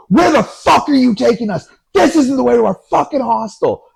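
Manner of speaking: high, loud, furious outburst; yelling. Retold or imitated. Friend yells at the cab driver about the wrong route. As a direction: explode at the driver, imitating an angry friend. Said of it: "Keeg just starts yelling at this guy".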